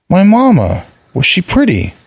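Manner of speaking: put-on high, pressing little boy voice; a child pushing for an answer; insistent child. A narrator's reading. Vali insists on his question.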